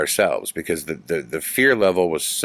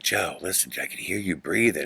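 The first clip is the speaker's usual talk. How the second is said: hushed whisper in the dark; 7 dB quieter than usual. Retold or imitated.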